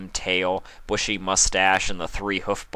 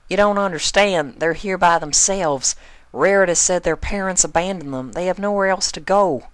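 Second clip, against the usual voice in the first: higher and louder, pressing; insistent higher pony voice; urgent farm pony; 11 semitones higher, 5 dB louder than usual. Acted. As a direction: make him grasp it's serious. Applejack urgent.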